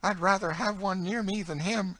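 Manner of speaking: fervent high-voiced eagerness; eager. A narrator's reading. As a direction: insist eagerly, pitched right up. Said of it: "said Emmeline eagerly".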